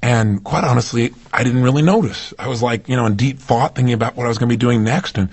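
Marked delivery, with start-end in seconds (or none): none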